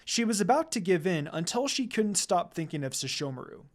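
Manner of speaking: usual speaking voice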